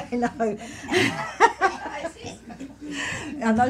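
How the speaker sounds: bursts into a laugh mid-greeting. Real, not acted. Medium greeting a spirit in the reading, laughing. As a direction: laugh at the spirit's joke, then let it ring out.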